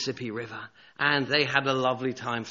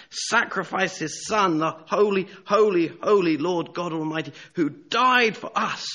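impassioned preaching; 5 semitones higher, 6 dB louder than usual. Real, not acted. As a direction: preach it forcefully, stretching the key words.

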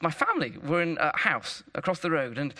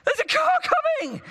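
loud warning yell, pitched very high; parent's high, urgent warning cry; urgent shout; 5 dB louder, faster than usual. Retold or imitated. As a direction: shout it across a busy road. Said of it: "I shouted".

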